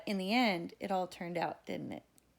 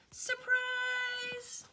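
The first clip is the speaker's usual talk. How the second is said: shouted surprise. A narrator's reading. Ladies surprise the bride, high shout. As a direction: leap out and shout it. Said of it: "all the ladies shouted".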